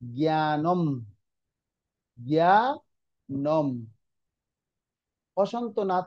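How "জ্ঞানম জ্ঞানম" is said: slow জ্ঞানম closing on a hummed m; joined final consonant. Shown as a demonstration. Teacher giving students the hasanta example, জ্ঞানম drawn out, closed m. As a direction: draw out জ্ঞানম as "gyaa-nom", lips shut at the end. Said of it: "হসন্ত থাকলে একসাথে উচ্চারণ হবে".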